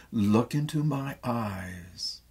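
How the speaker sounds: a lover pleading slowly for trust; slow plea. Acted. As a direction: draw it out to hold his gaze.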